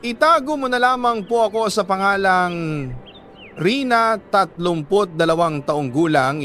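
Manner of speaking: usual speaking voice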